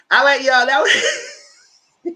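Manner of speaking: high, loud words breaking into laughter; real laughing. Real, not acted. Host to viewers after own rant, laughing. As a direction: crack up while trying to wrap up.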